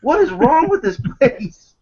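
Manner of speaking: high, incredulous cry; exasperated outburst. Retold or imitated. Gamer reliving the monkey-dropping eagle, exasperated high cry. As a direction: throw it out in disbelief. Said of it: "I'm like".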